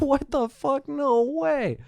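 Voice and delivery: high, shocked cry of disbelief; stunned disbelief. Retold or imitated. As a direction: react as if the news just hit you. Learns the screamer was a murderer.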